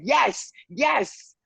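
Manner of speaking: high-pitched mock cheer; background cheer. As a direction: imitate someone cheering from across the room. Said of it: "someone in the background went".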